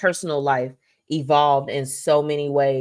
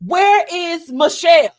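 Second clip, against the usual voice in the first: loud high call across the room; 11 semitones higher, 8 dB louder than usual. Retold or imitated. After the show, calling loudly for her friend. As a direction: shout for Michelle, searching. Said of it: "I'm just like".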